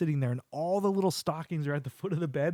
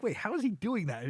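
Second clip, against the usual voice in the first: puzzled; 7 semitones higher than usual. Retold or imitated. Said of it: "you're like".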